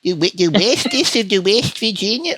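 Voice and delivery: high, grating goofy impression; mocking character voice. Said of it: "And this is his voice".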